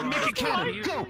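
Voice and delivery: rally the chat with a squeaky order; high excited command. Acted.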